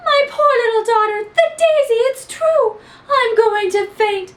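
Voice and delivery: high, loud near-fainting wail; a mother's shrieking despair; cried out. Acted. Missus McGrew wails about her daughter, high and loud. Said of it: "cried Missus McGrew".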